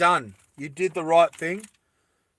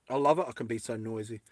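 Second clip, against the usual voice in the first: usual speaking voice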